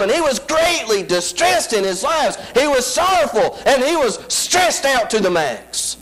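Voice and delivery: high, rising preacher's whoop; preaching at full force. Acted. Preacher whooping to the congregation about her struggle. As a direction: lift it into a sung sermon cry.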